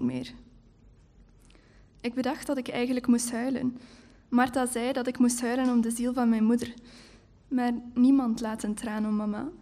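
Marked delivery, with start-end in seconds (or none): none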